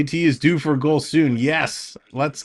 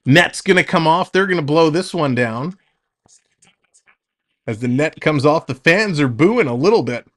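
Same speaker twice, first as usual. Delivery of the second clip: quick, lively hockey call; play-by-play. Acted. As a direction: call the action as it happens.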